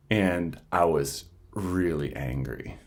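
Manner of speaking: ordinary talk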